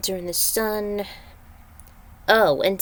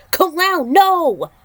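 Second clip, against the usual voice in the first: high, loud cry to stop him; a sudden loud shout of alarm; 10 semitones higher, 7 dB louder, slower than usual. Acted. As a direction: yell to stop a friend. Ally shouts to stop Kung Lao.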